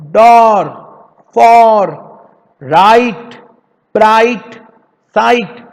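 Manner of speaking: each word called out loud, like a teacher to a class; loud word drill. Shown as a demonstration. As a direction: call the words out firmly. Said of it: "इसे हम हिंदी में कैसे बोलेंगे?". (ऊँची आवाज़ में शब्द बोलना)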